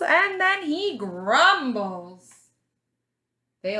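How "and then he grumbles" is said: put-on lively storybook read for little kids; reading to children. A narrator's reading. Teacher reading a picture book to her class, lively storybook voice.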